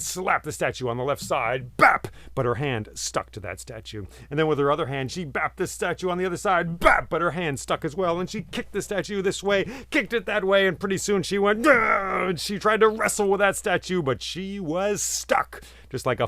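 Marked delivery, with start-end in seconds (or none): 1.8-2.1 sharp high "Bap!" slap noise; slap sound. A narrator's reading. Narrator voicing the spirit's slap on the statue. 6.8-7.1 slap sound. A narrator's reading. Second slap. 11.6-12.3 high loud effort grunt; straining grunt. A narrator's reading. Forest spirit straining, stuck to the statue.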